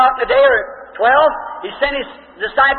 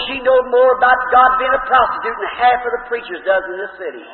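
ordinary talk

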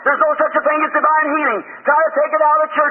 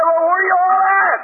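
taunting battlefield call; Goliath's challenge; 5 semitones higher than usual. Acted. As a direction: holler it across to a hidden army. Acts Goliath calling out his challenge.

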